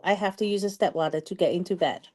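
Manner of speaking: ordinary talk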